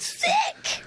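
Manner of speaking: very high, squeaky schoolkid cheer; impressed pupil. Acted. Pupil cheering the teacher's lesson, mock high squeal.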